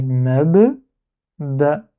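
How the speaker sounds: "mab" and "ba" said apart, a pause between; slow split reading of "mab, ba"; separated syllables. Shown as a demonstration. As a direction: release the b of "mab", then a separate "ba". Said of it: "lire en deux syllabes séparées".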